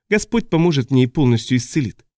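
usual speaking voice

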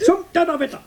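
high, laughing, crying cry of frustration; exasperated outburst. Acted. Brother losing patience with his crying sister, high exasperated outburst. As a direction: explode in helpless exasperation.

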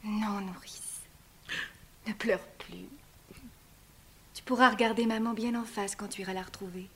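soft, hushed comfort; tender whisper. Acted. Antigone gently comforts her weeping nurse in a whisper. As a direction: soothe her tears.

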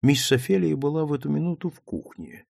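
ordinary delivery, nothing marked

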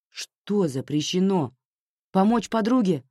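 indignant questioning, rising on the second question. A narrator's reading. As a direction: challenge her with offended disbelief. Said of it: "возмутилась Аня".

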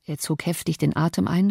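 ordinary delivery

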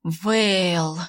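breathy languid exhale; languid sigh. A narrator's reading. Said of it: "томно выдыхаем".

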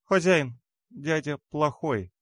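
высокий жалобный голосок малышки; пожалуйся тонко и медленно, по-детски; детский голос облачка. A narrator's reading. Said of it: "говорило облачко". (сонная детская жалоба)

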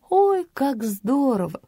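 slow, high-pitched admiring cry; exclaiming. A narrator's reading. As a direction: exclaim with mock-innocent wonder. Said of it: "воскликнула я".

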